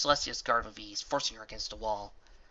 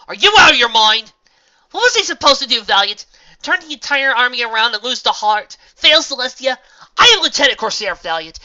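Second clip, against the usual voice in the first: high, loud angry voice; angry shout; 13 semitones higher, 20 dB louder than usual. A narrator's reading. Astor and Valiant argue angrily, loud. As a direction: shout back at him. Heated argument.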